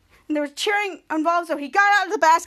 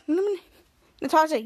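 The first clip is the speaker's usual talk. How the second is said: normal talking voice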